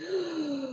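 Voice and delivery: long, soft breath out; quiet deep exhale. Shown as a demonstration. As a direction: breathe out slowly for everyone. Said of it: "Let's take a deep breath".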